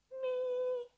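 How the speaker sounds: long high held syllable; high stretched me. Shown as a demonstration. Teacher showing how the meow stretches the face. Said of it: "So we're gonna try meow".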